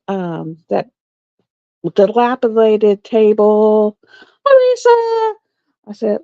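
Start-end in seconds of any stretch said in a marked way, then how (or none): 4.5-5.3 high sudden call of a name. Real, not acted. Reading a live chat, calls out a viewer's name. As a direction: pop the name out, surprised.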